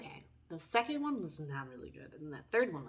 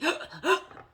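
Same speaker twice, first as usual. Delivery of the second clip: loud, high theatrical gasp for air; mock gasp; 7 semitones higher, 9 dB louder than usual. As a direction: gasp as if choking on air, hammed-up. Mock suffocating after quote.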